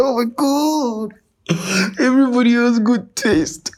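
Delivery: high, exaggerated gushing; mock gushing. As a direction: ham up the excitement.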